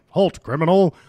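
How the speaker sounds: loud mock police command; RoboCop. Joking about the actor's old cop role, mock command.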